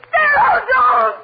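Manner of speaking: wife crying out as her husband attacks; terrified cry. Acted. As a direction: plead for her life. Being strangled.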